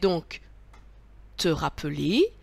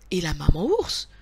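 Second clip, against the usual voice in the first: ordinary delivery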